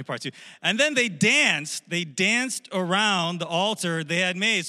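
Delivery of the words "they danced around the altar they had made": usual speaking voice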